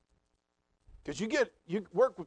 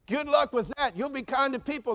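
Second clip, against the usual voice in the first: scoffing skeptic; 7 semitones higher, 5 dB louder than usual. Acted. Skeptic voice mocks kindness.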